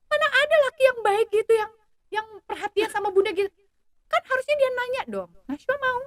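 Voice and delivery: indignant daughter. Retold or imitated. Daughter to mother about the date, high and indignant. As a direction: mimic a daughter outraged at a stingy date. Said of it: "katanya gitu".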